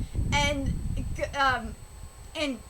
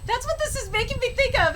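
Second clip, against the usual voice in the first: higher, louder burst of recognition; excited realization; 7 semitones higher, 7 dB louder than usual. Real, not acted. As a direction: blurt it out as it finally clicks. Sudden recall of the film.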